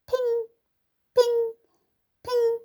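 high, light pings; ping each one up high; ping sound effect. A narrator's reading. Sound of hail bouncing.

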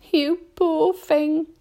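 slow, high, pitying voice; sympathetic murmur. A narrator's reading. Miss Spider pities the centipede, murmuring slowly. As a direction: draw it out with tender pity. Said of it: "she murmured".

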